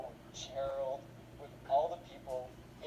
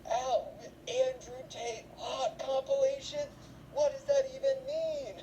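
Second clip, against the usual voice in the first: high, slow mock-baffled mother; a hammed-up mom reading her son's search history; outraged mom; 11 semitones higher, 3 dB louder, slower than usual. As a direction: read the title out in disbelief. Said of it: "she's like".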